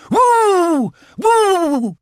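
high, loud hooting howls; mock-spooky drawn-out wails; pretend ghost wail. A narrator's reading. Small animals in sheets haunting Owl, pretend ghostly. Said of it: "pretending to be ghosts".

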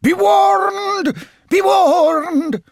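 very high, loud wail; ghostly warning. A narrator's reading. Chimney voice frightening Owl away, ghostly. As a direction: howl it with long stretched vowels. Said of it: "the voice from the chimney said".

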